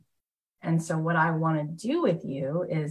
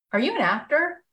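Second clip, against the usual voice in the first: curious stranger; 10 semitones higher, 3 dB louder than usual. Retold or imitated. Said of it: "he goes".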